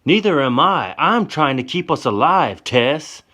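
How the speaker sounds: high, loud angry reply; loud, frustrated insistence; heated yell. Acted. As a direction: fire it back at her. Johnny arguing back, heated.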